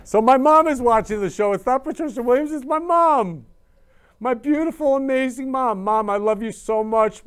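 high, loud, thrilled announcement; gushing pride. Real, not acted. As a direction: show off your mom to everyone, bursting with love. Gushing about mom watching.

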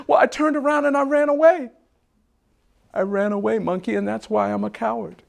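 high-pitched mock wail, easing off; cowardly lion confessing. Lion admits to Monkey he fled, high upset confession. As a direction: begin high and upset, then let it settle.

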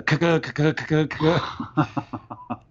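stuttering mimic of a grinding car; mouthed clunking car noise; imitated grinding noise. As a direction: make the sputter of a dying engine. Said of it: "just this grinding, horrible noise".

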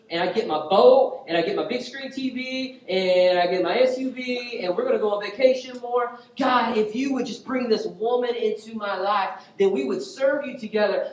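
high, loud, escalating wish list; mock fervent prayer. Preacher imitating a self-serving prayer to God, mock fervent. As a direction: plead eagerly at full preaching force.

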